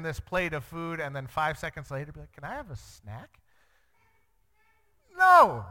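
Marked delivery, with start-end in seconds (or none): 2.3-3.4 lightly voiced kid's request, soft with rising end; small, quiet hopeful ask, imitating a child; a hint of a pleading kid. Child voiced asking for snack. 5.2-5.6 loud, high-pitched mock refusal; acted parental outburst, sharply louder; exasperated parent.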